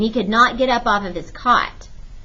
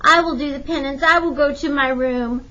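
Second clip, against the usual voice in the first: put-on high-pitched, pleading child; begging; 6 semitones higher, 4 dB louder than usual. A narrator's reading. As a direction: beg mother to relent. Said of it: "Lupe begged".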